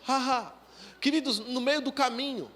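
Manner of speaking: ordinary delivery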